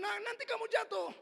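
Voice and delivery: high, loud warning; father's warning call. Retold or imitated. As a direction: call out sharply to a child.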